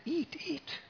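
put-on a child near tears, begging; tearful little boy. Little boy pleads again with Gopinath, high and tearful.

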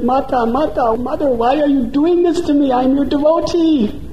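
high, frightened begging; terrified pleading. Acted. Dacoit leader begging the goddess to spare him, terrified. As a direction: plead for your life in terror.

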